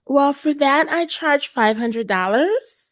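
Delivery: high, tentative, rising into a question; apologetic tone. Shown as a demonstration. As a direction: quote your price as if asking permission. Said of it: "almost in a apologetic tone".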